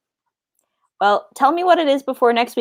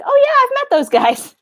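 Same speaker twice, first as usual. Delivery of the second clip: much higher, breezy mock Frodo; high, casual Frodo impression; 3 dB louder than usual. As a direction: play Frodo shrugging it off. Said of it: "Frodo's just like".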